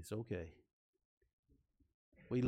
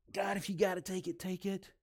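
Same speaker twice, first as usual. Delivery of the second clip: grudging giver; 5 semitones higher, 4 dB quieter than usual. Retold or imitated. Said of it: "Don't give to God like".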